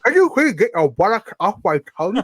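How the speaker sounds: mock-polite dragon with something stuck in his mouth; talking past something caught on the tongue, laughing at the end; imitating Draco. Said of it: "And Draco goes".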